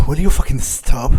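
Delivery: normal talking voice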